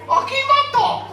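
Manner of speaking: a fisherman yelling up high to his friend; frantic high shout. Acted. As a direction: call out high and panicked across the boat. Japanese fisherman calling out.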